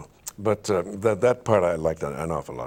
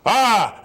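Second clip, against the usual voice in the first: loud, high sung ah; big open ah; 11 semitones higher, 6 dB louder than usual. Shown as a demonstration. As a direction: belt out a bright ah. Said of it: "you make that kind of sound".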